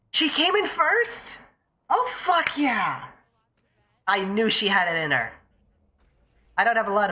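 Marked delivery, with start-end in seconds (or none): 0.1-3.0 way up high and drawn out slow; thrilled disbelief. Real, not acted. Streamer hearing her friend won first, elated. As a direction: shriek with joy at the news.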